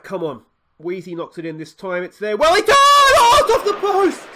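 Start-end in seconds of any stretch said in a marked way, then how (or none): 2.0-4.3 high, loud yelling, breaking into a laugh; excited shout. Real, not acted. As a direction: roar then laugh at the post. Shot hits the post.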